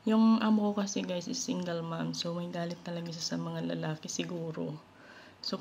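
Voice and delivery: ordinary talk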